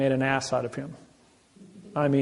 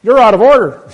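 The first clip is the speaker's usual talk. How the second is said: very loud, much higher ruling from the bench; judge's shout; 8 semitones higher, 17 dB louder than usual. Retold or imitated. Judge perks up and rebukes the attorney general, loud shout. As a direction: slam it down like a gavel. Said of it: "the judge perks up and says".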